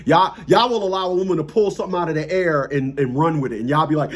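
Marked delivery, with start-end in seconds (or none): none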